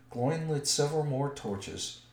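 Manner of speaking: usual speaking voice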